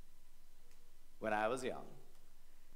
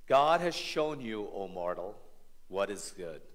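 solemn reading; 5 semitones lower than usual. Acted.

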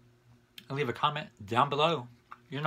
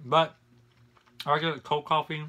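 chewing while talking; muffled by food; mouth full. Real, not acted. Seen 3: bite taken at -1.0; lips closed, jaw working between words.

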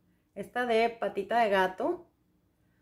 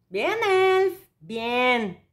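excited cheer; 2 semitones higher, 5 dB louder, much slower than usual. Real, not acted. Praising the cheap product.

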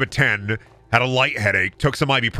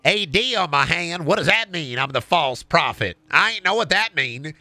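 Larry the Cable Guy; 3 semitones higher than usual. Acted. Larry as BioShock hero puzzled by his hand, hammed-up Southern accent. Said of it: "it's Larry the Cable Guy".

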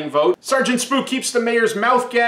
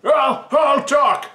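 frightened mayor; 8 semitones higher than usual. Acted. Beaten mayor cracks.

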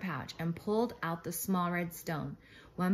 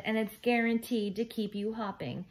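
higher, mildly raised storybook yell; 5 semitones higher, 4 dB louder than usual. A narrator's reading. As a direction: call it out over the jar. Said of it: "he yelled as he unscrewed".

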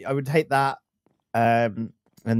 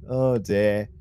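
ordinary talk, nothing marked

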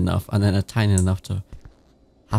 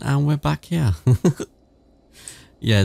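ordinary delivery

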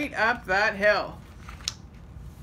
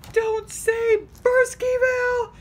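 high desperate plea, much louder; pleading; 7 dB louder than usual. Acted. Shifty begs the radio not to name his town, high pleading cry. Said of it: "pleaded Shifty".

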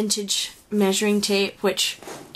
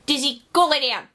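raised, louder order to the dog; dog command; 6 semitones higher, 5 dB louder than usual. Real, not acted. Owner telling her dog to lie down, raised firm command. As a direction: break off and firmly send the dog away.

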